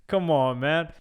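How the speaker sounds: ordinary talk, nothing marked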